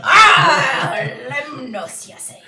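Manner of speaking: hammed-up pirate, big loud opening; booming pirate "Ah"; pirate sea captain. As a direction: play a sea dog, loud then dropping away. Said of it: "only way I know how to play sea people".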